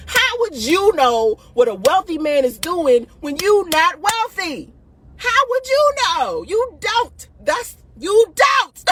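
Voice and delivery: high, drawn-out exasperated challenge; heated, high-pitched rhetorical outburst; getting emotional. Real, not acted. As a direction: cry out in worked-up disbelief. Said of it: "I'm getting emotional".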